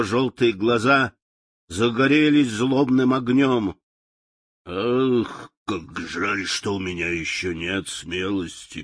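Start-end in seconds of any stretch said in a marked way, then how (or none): 4.6-8.8 soft rueful lion voice; quiet thinking lion. A narrator's reading. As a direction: muse it quietly to himself. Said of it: "подумал он". (тихо, с сожалением)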